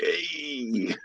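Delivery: high, put-on Italian greeting; Italian guy voice. Friend guessing the reviewer's voice, mock Italian greeting. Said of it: "a fat Italian guy that's just like".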